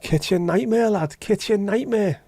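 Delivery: high, slow mocking show-host voice, pitched well up; hammed-up parody of a TV chef. Mocking Gordon Ramsay's show.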